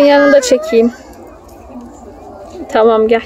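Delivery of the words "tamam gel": ordinary talk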